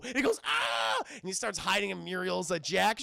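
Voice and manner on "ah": very high-pitched mock scream; imitated frightened yelp; cartoon scream. Courage screams at the birds.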